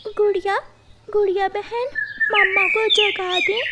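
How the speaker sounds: put-on high small child voice; little chick calling. A narrator's reading. Chick asks her sister to wake mother, childlike. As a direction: ask big sister gently.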